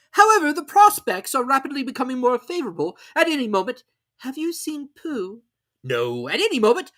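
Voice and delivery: a pompous owl calling from the air. A narrator's reading. Owl and Robin voiced.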